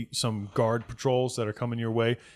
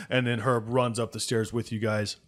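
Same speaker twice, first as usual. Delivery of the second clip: usual speaking voice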